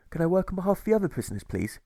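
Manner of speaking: a prisoner's polite hopeful plea; asking politely. A narrator's reading. Alfred asking the jailer to work for other prisoners. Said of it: "he asks as he passes his empty plate".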